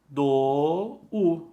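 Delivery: ordinary talk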